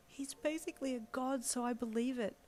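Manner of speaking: high, reverent fangirl voice; awed co-worker. A narrator's reading. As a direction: worship him in a high little voice.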